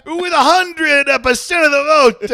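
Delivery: high, loud, slow hammed-up proclamation; mock announcer. Announcing Mama's victory, hammed-up Italian announcer. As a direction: announce the landslide like a TV anchor.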